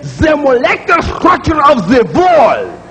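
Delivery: the wall said as vall, W turned to V; mock Russian W as V; light Russian accent. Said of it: "he'll give you a labial dental fricative".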